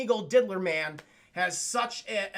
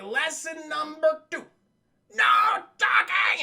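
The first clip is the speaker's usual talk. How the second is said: slow build to a loud shout on talking; loud villain proclamation; 7 semitones higher, 7 dB louder, slower than usual. Retold or imitated. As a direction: bellow the rule at the kids. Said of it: "you're so loud".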